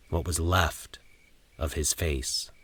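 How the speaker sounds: ordinary delivery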